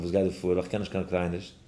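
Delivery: ordinary delivery, nothing marked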